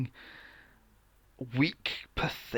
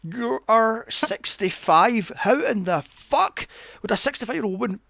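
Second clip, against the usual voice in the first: incredulous rant; 7 semitones higher, 5 dB louder than usual. Retold or imitated. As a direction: shout it at the screen in disbelief. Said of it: "I'm like".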